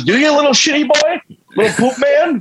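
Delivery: high, squeaky mock condescension. Stranger belittling the driver, high squeaky mockery. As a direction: talk down to him like a toddler. Stranger mockingly voiced, insulting narrator.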